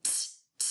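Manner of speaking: t said as a crisp puff; t repeated with a pause; exaggerated word endings. Shown as a demonstration. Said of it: "The ending sound I hear is".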